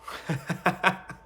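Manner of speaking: short airy laugh; breathy laugh. Real, not acted. Admitting he killed Shimura, airy sheepish laugh. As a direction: let out a quick breathy laugh. Laughing at his own choice.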